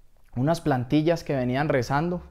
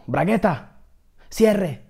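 sharp, higher gang-leader yell; shouted command; 5 semitones higher, 4 dB louder, much slower than usual. Acted. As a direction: call out an order to your gang. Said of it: "miró a la puerta y gritó".

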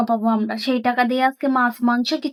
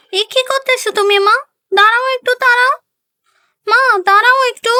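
high, louder alarmed protest; shocked daughter; 9 semitones higher, 6 dB louder than usual. Acted. Daughter catches mother watering the milk, high and alarmed. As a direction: stop her in alarm.

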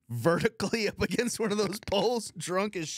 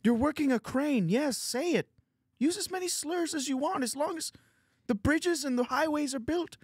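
high-pitched, strained Canadian Kermit voice; Jordan Peterson; 8 semitones higher than usual. Acted. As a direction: argue the case like a Canadian Kermit. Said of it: "in Jordan Peterson's voice".

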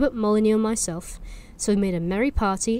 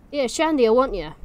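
calling out; 4 semitones higher, 6 dB louder than usual. Acted. Villager calls out to Shandy.

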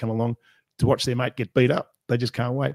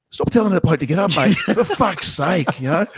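higher, exasperated complaint, slowing on for fuck's sake; mock annoyed fighter; 6 semitones higher, 3 dB louder than usual. Sammy telling the commentator off, mock exasperated. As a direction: play the fed-up fighter moaning.

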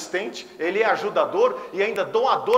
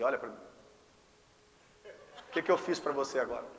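ordinary delivery

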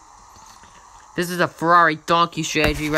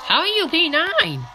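voice crack; 14 semitones higher than usual. Real, not acted. Gamer shocked at his position, voice cracking. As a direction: voice cracks high. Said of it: "That was a voice crack".

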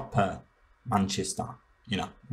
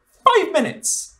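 a much higher, slow, loud cry of disbelief; outraged shriek; 7 dB louder, much slower than usual. Real, not acted. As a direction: stretch it out in mock outrage. Outraged exclamation.

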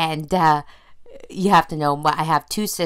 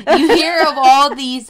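high, loud mock American accent; slow, hammed-up American accent; 8 semitones higher, 8 dB louder than usual. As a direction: put on a big, loud American voice. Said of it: "that's my best American accent for you".

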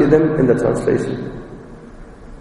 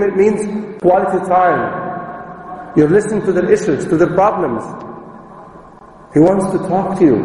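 ordinary talk

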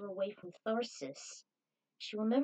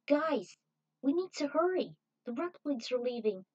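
raised, higher voice urging the group to hurry; hurried shout to the group; urgent call; 6 semitones higher, 5 dB louder than usual. Acted. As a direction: call them to hurry, loud and high.

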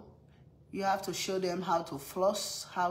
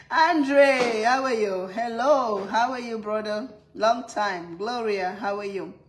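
loud, bright hello to a friend; big live-stream shout-out; calling out greetings; 3 semitones higher, 8 dB louder than usual. Real, not acted. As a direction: call out warmly to viewers.

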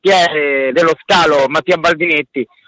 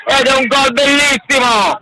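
excited goal call; 8 semitones higher than usual. Real, not acted. Goal call, first take.

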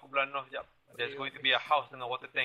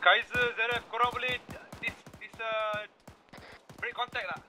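shouting orders over battle; 9 semitones higher, 5 dB louder than usual. Real, not acted. Wounded, calling squadmates in fight.

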